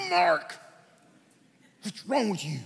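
imitating an annoyed, woken-up brother; mildly acted sibling exasperation; irritated brother. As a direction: scold the kid who woke you.

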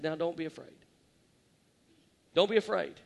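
Jesus quietly reassuring trembling John; gentle reassurance. Retold or imitated. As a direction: soothe a terrified man back to his feet. Jesus gently comforts fallen John.

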